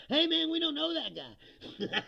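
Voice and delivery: high, loud, slow, laughing through it; chuckling fake innocence; laughing mock denial. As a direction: deny him with a laugh in a silly high voice. Mocking supporters disowning him.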